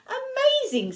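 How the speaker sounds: high swoop of astonishment; amazed. A narrator's reading. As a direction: marvel at it in a storybook way. Gruffalo amazed in story.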